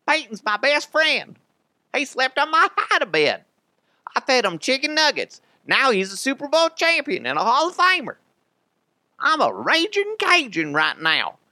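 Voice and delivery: high, loud Cajun bellow; hammed-up raging Bayou boast; Brandon Stokley Cajun voice. Host doing his signature Stokley line in a loud, high Cajun voice. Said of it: "It's a horrible Stokley impression".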